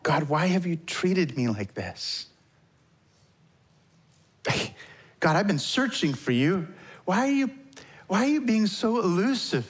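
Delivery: mildly exasperated plea, pitch climbing; searching, slightly frustrated prayer; questioning God. Acted. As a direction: plead upward, drawing out the first question. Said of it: "have you ever asked those questions of God".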